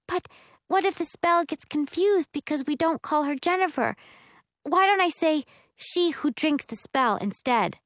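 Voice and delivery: high-pitched anxious overthinking; earnest, fussy sister; young spellcaster fretting. Acted. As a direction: work through the worry in a high girl's voice.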